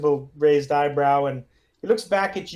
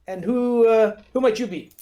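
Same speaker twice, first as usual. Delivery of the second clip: put-on Russian accent; 6 semitones higher, 3 dB louder than usual. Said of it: "rough, Russian sort of accent".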